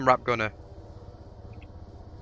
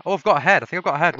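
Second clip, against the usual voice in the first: loud and higher, spotting a target; sudden thrilled callout; excited callout; 5 semitones higher, 8 dB louder, much faster than usual. Real, not acted. Spotting an enemy.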